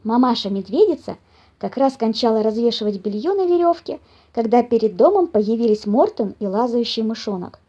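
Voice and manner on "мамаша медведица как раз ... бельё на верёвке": ordinary delivery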